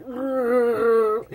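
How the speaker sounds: bear growl. Retold or imitated. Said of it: "normally when bears are like".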